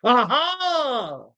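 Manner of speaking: high, drawn-out gotcha cry; demonstrative triumph. Acted. Old customer springs his point on deli owner, triumphant. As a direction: make a big show of it. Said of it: "very demonstrat-, demonstrative".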